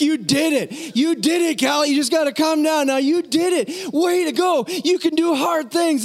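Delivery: high, jubilant cheering; hollering praise. Retold or imitated. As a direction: holler proudly up to the child. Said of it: "So I'm still hollering".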